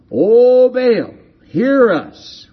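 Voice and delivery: high, loud drawn-out cry to a god; calling out. Acted. Worshippers calling out to Baal.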